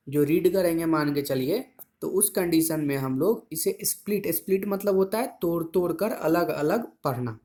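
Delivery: ordinary talk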